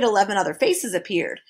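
plain reading voice

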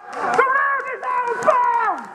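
high, slow shouted call. Real, not acted. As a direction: call it out across the field. Calling rule to players.

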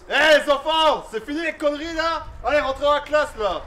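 put-on loud teacher calling kids in; scolding teacher. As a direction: shout like a strict teacher.

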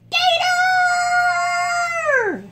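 high, piercing mock scream; frog screams. A narrator's reading. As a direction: shriek it like a terrified frog. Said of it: "and the frog screams".

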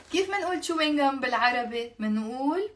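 ordinary talk, nothing marked

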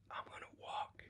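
imitating a determined but feeble son; barely audible, all breath; weak whisper. Sick son answering his father, weak whisper. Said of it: "just barely able to whisper".

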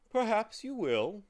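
high, yawned-out agreement; yawning. A narrator's reading. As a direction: say it through a loud yawn. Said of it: "agreed with a yawn".

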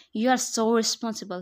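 loud mocking yell; sarcastic yell. A narrator's reading. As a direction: spit the praise out as an insult. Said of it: "he yelled again".

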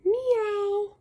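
high, mewing cat voice; cat meow. Acted. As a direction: mew it like a kitten. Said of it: "What does a cat say?".

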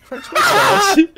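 high, loud laughing words; cracking up. Real, not acted. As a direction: squeal it slowly through laughter. Cracking up at the accent bit.

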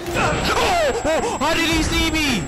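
panicked high yelps; screaming in sudden fright; panicking gamer. Real, not acted. Chased by monster, screaming.

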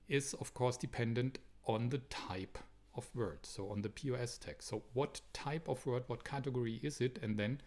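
normal talking voice

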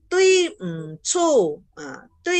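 TUI and CU each dropped steeply; short falling tones on "tui" and "cu"; sharp fourth tone. Shown as a demonstration. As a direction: start "tui" high and let it fall. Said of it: "ini nada empat".

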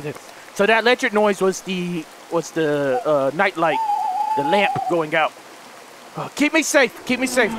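ordinary talk, nothing marked

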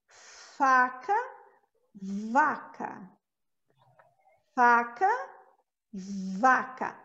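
faca with short F, vaca with held buzzing V; faca versus vaca. Shown as a demonstration. Teacher contrasting faca and vaca. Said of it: "quando eu pronuncio o V, eu seguro mais".

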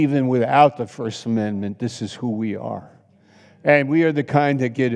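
ordinary delivery

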